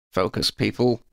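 through gritted teeth, called. A narrator's reading. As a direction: call it through clenched teeth. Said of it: "Oddie called through gritted teeth".

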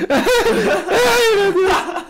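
very high words squeezed through laughter; helpless laughter. Real, not acted. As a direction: gasp it out while cracking up. Cracking up at friend's remark.

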